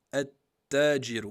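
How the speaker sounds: at-tajiru with the lam silent, the t doubled; Arabic demo word. Shown as a demonstration. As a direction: say at-tajiru slow and loud, doubling the t. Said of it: "on le prononce pas". (at-tajiru : pas de lam, appuyez la shadda sur le t)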